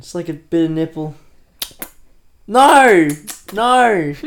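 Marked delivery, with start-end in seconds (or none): 2.5-4.1 shouted no, high and loud; mock shriek. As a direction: cry out no in mock horror. Mock scene of exploding chest.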